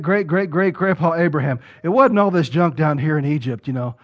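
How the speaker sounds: ordinary talk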